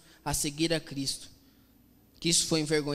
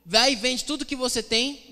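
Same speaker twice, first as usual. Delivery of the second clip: louder, higher command as Jesus; acted firm instruction; 6 semitones higher, 6 dB louder than usual. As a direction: lay down the condition to a follower. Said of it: "Jesus fala assim".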